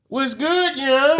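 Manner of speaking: high, loud D C greeting, hammed-up; a big, bright D C hello in a put-on accent; DC street slang. Comic showing the crowd his D C bit, in a hammed-up D C accent. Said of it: "Then I did D C".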